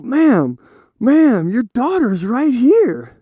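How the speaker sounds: high, drawn-out appeal; imitated pleading insistence. Officer to the mother beside her daughter, pleading. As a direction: stretch it out as an exasperated plea.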